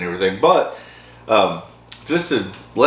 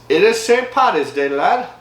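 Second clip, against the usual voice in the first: higher-pitched mock Irish lilt; 9 semitones higher than usual. Host celebrating Saint Patty's Day with viewers, mock Irish. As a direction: put on a playful Irish lad accent. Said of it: "I don't know why I'm talking like that".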